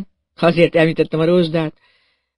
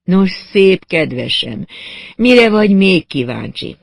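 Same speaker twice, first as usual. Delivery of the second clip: slow, then louder and higher, taunting; mocking challenge; 4 semitones higher, 6 dB louder, slower than usual. Amelie taunts Johnny after her confession, slow and louder. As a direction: dare him to ask more.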